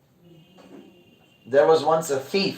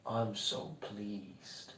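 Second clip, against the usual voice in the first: very soft, very slow divine calm; hushed, unhurried blessing; serene deity; 4 semitones lower, 19 dB quieter, much slower than usual. Acted. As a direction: speak as a peaceful god, barely audible. Lord Shiva answers the thief.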